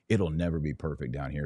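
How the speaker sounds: ordinary talk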